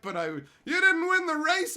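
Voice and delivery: high, loud caricature; mock protest. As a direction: imitate a melodramatic scolding. Mock protest in acted exchange.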